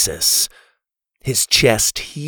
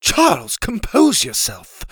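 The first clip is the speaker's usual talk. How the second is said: put-on high, measured voice in a proper accent; classy lady's proper accent; 8 semitones higher than usual. A narrator's reading. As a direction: chide him in a refined, proper accent. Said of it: "Ma said in her proper accent".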